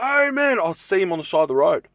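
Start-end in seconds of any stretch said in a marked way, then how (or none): 0.0-0.6 high-pitched called-out greeting; retold yell. Driver hailing a dad on the roadside, retold yell. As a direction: call out as if hailing a friend from afar. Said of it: "I yell out his name".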